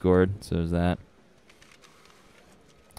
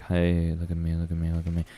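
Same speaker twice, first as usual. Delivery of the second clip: low, soft near-whisper; hushed demo; 5 semitones lower, 9 dB quieter than usual. Shown as a demonstration. Streamer showing the mic stays out of the red, hushed. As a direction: murmur it softly and low. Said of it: "when I'm whispering".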